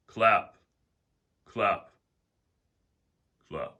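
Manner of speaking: sharp, loud single words, fading by the last; isolated loud bursts dying away; sudden thunderclaps. Shown as a demonstration. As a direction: punch each word like a lightning strike, then ease off. Voices lightning strikes in meditation.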